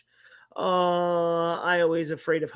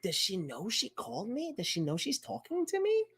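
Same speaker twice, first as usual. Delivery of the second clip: hushed aside; 10 dB quieter than usual. Retold or imitated. Muttering to Kelsey during call.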